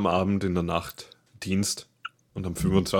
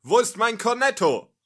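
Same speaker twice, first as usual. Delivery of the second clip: schrill-hohe Comicfigur in Panik; hoch und gehetzt, übertrieben komisch; hohe hektische Stimme; 7 semitones higher than usual. Acted. Hektischer Sketch-Held, flieht und kämpft. (hohe, panische Comicstimme)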